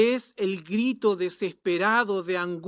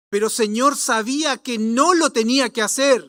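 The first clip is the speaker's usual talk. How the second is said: louder, raised-pitch confession; anguished protest to God; 5 semitones higher, 8 dB louder than usual. Acted. Believer admitting wrongdoing to the Lord, anguished protest. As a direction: plead loudly with the Lord.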